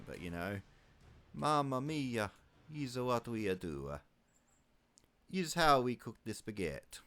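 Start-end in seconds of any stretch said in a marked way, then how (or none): 1.3-7.0 mock Italian accent. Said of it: "his thick Italian accent".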